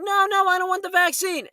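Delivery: high-pitched mock kid's refusal; imitated protesting child. Imitating a child refusing grandmother, high protesting voice. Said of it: "And the child's like".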